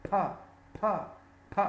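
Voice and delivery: the bare P sound, a puff without throat vibration; isolated voiceless "p"; unvoiced P. Shown as a demonstration. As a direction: say "p" as a short voiceless pop. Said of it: "doesn't produce vibration in the throat".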